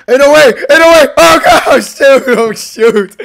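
loud laughing disbelief; laughing shout. Real, not acted. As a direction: laugh it out in shock. Laughing after a jump scare.

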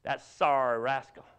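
low, grudging put-down; hammed-up disapproval; mock disgust. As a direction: drop the voice into sour dismissal, imitating a fickle churchgoer. Same churchgoer abruptly turns on preacher.

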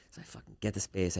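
ordinary talk, nothing marked

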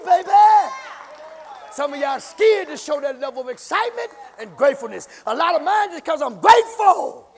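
loud, high shouted preaching; rising from testimony to a full shouted praise break; full-force preaching. Acted.

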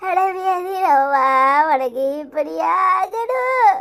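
mock funeral lament. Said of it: "இப்படி ஒப்பாரி வச்சுக்கிட்டு இருக்க".

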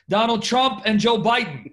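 amused, punchy exclamation; animated amusement. Real, not acted. Joking about heated presidential debate.

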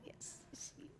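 ordinary talk, nothing marked

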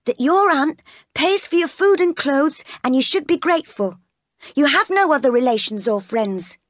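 high-pitched, insistent lecture; admonishing maid. Acted. Bessie reminding Jane to be grateful, admonishing. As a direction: lecture the child on her place.

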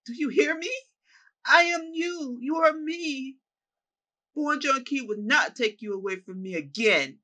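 high, loud desperate shouts; yelling. Acted. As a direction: scream it mid-fight, begging to be seen. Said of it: "he yells at Esther G".

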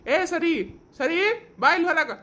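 husband angrily orders his wife outside; angry shout. Acted. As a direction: bark her outside in a temper.

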